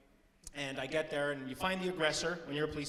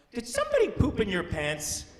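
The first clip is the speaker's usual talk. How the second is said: put-on playful question to a child; 6 semitones higher, 7 dB louder than usual. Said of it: "I went down and I went".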